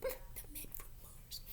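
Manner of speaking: faint breathy whisper; barely a breath, hushed in awe; very soft scared whisper. A narrator's reading. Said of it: "declared Scapito".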